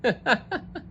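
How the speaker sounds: high squeaky laugh. Acted. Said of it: "Moose started to laugh and laugh".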